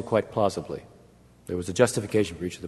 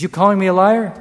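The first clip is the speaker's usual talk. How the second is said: much higher and louder, almost shouting; indignant near-shout, pitched way up; angry challenge; 10 semitones higher, 8 dB louder than usual. Retold or imitated. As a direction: hurl the question back at him. Arafat's third, loudest challenge.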